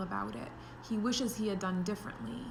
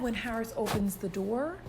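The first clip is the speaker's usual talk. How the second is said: usual speaking voice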